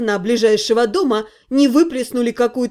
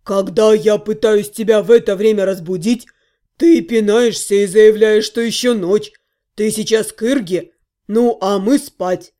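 a hint of a lower, grumpy gnome; lightly voiced grumpy half-gnome; 5 semitones lower, 3 dB louder than usual. Half-gnome grumbling at the girl, lightly voiced. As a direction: grumble like a tired friend.

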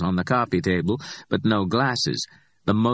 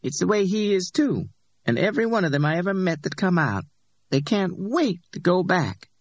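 higher-pitched, defiant Simone; Simone's voice, defiant; 12 semitones higher than usual. A narrator's reading. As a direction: lift the voice up for Simone and push back. Simone judging ex-convicts.

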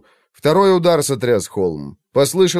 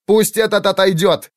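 high, loud angry demand; growled command; 8 semitones higher, 4 dB louder than usual. A narrator's reading. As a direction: snap the order at him. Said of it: "рыкнул Иллиан".

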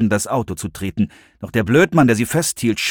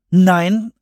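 high, loud, firm refusal; emphatic, raised-pitch rejection; very decisive; 9 semitones higher, 5 dB louder than usual. A narrator's reading. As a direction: refuse with total determination. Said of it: "sagte Serenity mit aller Entschiedenheit".

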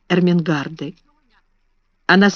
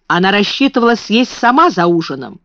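plain reading voice

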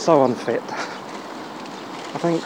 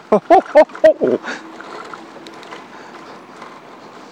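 laughing; 12 semitones higher, 7 dB louder than usual. Real, not acted. Thrilled by the e-bike boost.

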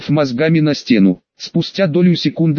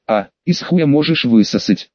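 plain reading voice

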